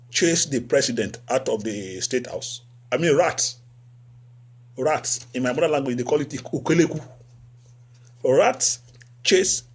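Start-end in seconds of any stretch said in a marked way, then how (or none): none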